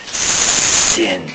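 SIN with a long, hissing S; hissed. Shown as a demonstration. Preacher likens the word to a vicious viper, hissed. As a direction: draw out the S in SIN like a snake. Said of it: "the hissing sound of a vicious viper".

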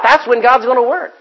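ordinary delivery

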